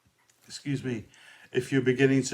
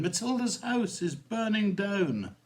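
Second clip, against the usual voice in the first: firemen's cry; 11 semitones higher than usual. A narrator's reading. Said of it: "galloped roaring through the town".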